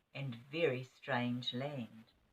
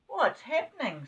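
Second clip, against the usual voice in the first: frightened; 9 semitones higher, 5 dB louder than usual. Acted. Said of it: "said Bessie, frightened".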